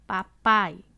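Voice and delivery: "Papai" said loud, with the A in "pai" standing out; stress on the A. Shown as a demonstration. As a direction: make the A of "Papai" ring clearly. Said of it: "O A aparece mais".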